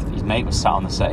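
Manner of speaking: usual speaking voice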